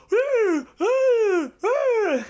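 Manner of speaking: loud, high horse whinny imitation; three high, held mock neighs; imitated horse neigh. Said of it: "my version of a horse neighing".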